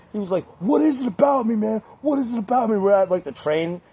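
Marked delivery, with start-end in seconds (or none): 0.6-2.8 high, wounded mock outrage; mock-furious high-pitched complaint; mad drunk friend. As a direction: imitate an angry drunk friend protesting, pitched way up. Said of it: "he was so mad".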